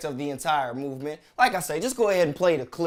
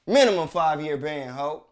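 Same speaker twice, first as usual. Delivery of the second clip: ordinary delivery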